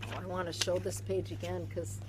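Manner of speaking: hushed whispered aside. Real, not acted. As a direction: whisper it as if sharing a secret. Whispered aside about the page.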